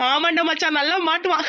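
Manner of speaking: words tipping into high laughter; gloating laughter. Acted. Friend agreeing she will be caught, gloating laughter. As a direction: relish the coming trouble and laugh.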